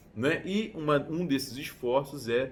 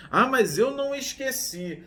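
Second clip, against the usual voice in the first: grudging retort; 9 semitones higher, 5 dB louder than usual. Retold or imitated. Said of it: "a pessoa vem".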